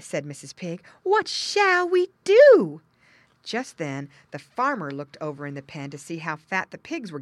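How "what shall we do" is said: high, loud, very slow; dismayed mother pig. A narrator's reading. Mother pig sees the hole, high dismay. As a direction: draw it out in high alarm.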